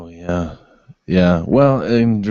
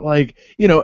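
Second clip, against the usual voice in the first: usual speaking voice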